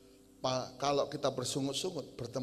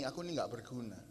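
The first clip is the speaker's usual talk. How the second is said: put-on quiet hopeless child; 3 semitones lower, 8 dB quieter than usual.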